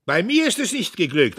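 loud, high speech; loud, high oration; bellowing orator. A narrator's reading. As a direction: harangue the hall. Diederich harangues the hall.

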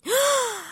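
high, sharp gasp; disbelieving gasp. A narrator's reading. Dory surprises herself by remembering, disbelieving gasp. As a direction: gasp in amazement. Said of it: "ثم شهقت غير مصدقة".

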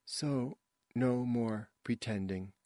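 soft, slow recital with pauses; wooden amateur actress. A narrator's reading. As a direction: say it softly, word by word. Wooden actress's part in rehearsal.

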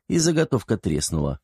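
ordinary delivery, nothing marked

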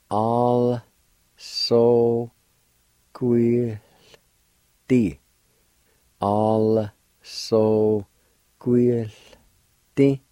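All, so, quill said as separate syllables with pauses. Shown as a demonstration. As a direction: spell it out slowly for learners. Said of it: "like this".

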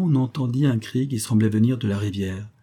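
ordinary delivery, nothing marked

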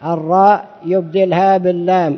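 normal talking voice

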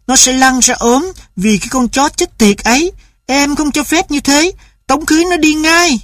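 a wife refusing the dog; furious mother. A narrator's reading.

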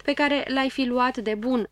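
ordinary delivery, nothing marked

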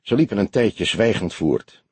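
ordinary delivery

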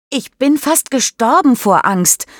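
high-pitched indignant complaint; complaining. A narrator's reading. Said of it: "beschwert sich Mischa". (hohe, empörte Beschwerde)

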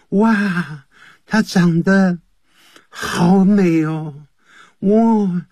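high, slow, drawn-out awe; hammed-up swoon over a beauty, stretching the words; swooning admiration. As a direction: gush dreamily, as if drooling over someone. Acts drooling over a beautiful girl.

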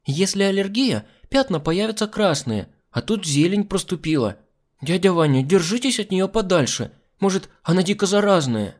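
put-on high-pitched, cheeky child's voice; pert little girl. Girl warning the man off the hostess, pert. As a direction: warn like a know-it-all little girl.

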